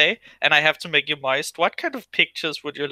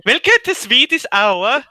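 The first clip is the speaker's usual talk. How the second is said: put-on higher sing-song Swedish accent; 6 semitones higher, 4 dB louder than usual. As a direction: welcome them like a Swedish host. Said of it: "I can't do that voice".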